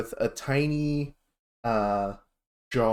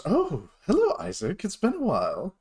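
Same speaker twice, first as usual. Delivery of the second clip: a friendly mentor's greeting, pitched higher; old dragonborn mentor; 7 semitones higher than usual. Acted. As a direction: greet an old student warmly, mock-surprised. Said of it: "he goes".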